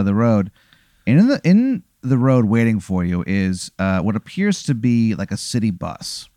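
normal talking voice

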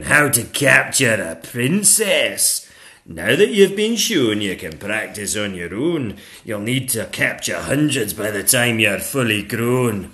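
loud, commanding dragon teacher; dragon schoolmistress. Acted. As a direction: project like a teacher instructing her class. Madam Dragon teaches capturing.

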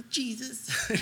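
high mock mom voice; mother pleading. Mom approaching Jesus for her sons, high mock pleading. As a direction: put on a mother's high coaxing call.

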